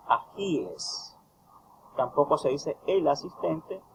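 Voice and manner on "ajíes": "ajíes" spoken very slowly, every syllable clear; slow, careful "ajíes" with a falling end; correct plural. Shown as a demonstration. Tutor spelling out the correct "ajíes" for students.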